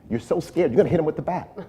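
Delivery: high, loud, laughing panic; mock panic. Retelling his brother's example, a frightened cop voiced high and loud with a laugh. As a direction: play the jumpy cop who panics over a sneeze.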